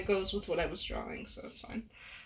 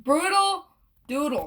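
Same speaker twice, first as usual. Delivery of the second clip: announcer shout; 6 semitones higher, 10 dB louder than usual. Acted. Announcing the show name.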